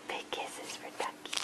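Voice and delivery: soft breathy whisper. Real, not acted. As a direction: whisper it tenderly. Whispered repeat.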